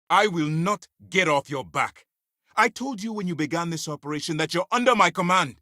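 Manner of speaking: heated assertion of command; angry commander. Acted. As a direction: pull rank on a defiant subordinate. Commander asserts authority over subordinate.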